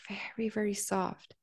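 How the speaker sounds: hushed, near-whisper emphasis; delicately quiet, voicing a very gentle touch; soft. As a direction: drop right down to a soft hush. Describes massaging very softly.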